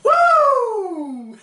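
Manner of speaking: high, loud whoop; excited whoop. Real, not acted. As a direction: whoop at your own joke. Cheering after a pun.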